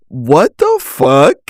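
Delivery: slow, drawn-out disbelieving what the fuck; shocked disbelief. Retold or imitated. Reading his flirty reply, stunned and slow. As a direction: let the shock stretch it out.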